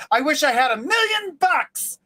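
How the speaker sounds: high, emphatic wishing voice; eager wish. Acted. Man making his one wish to a genie, eager. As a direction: proclaim the wish with a lifted pitch.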